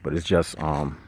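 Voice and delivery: low, hushed voice; quieted down. Real, not acted. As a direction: keep it down so passers-by don't overhear. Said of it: "I'm gonna quiet down".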